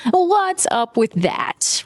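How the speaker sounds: high, slow, drawn-out mock question; sarcastic echo of a feeble callout; mock sarcastic repeat. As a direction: repeat it to mock how weak it is. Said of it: "Really asking the hard-hitting questions".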